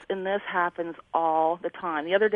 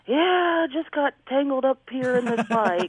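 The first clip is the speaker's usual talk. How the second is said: put-on drunk mom; 6 semitones higher, 3 dB louder, slower than usual. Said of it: "She's like".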